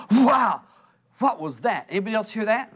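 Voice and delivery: loud, high burst of amazement; startled. Acted. Person jolted by the Spirit, startled. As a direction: blurt it out, then ask around, astonished. Acted shock at hearing the Spirit.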